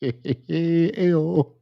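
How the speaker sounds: ordinary delivery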